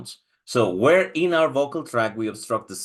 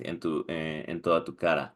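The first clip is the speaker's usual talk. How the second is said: ordinary talk, nothing marked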